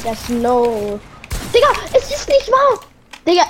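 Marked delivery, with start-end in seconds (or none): 1.5-3.4 outraged disbelief. Real, not acted. Enemy won't die.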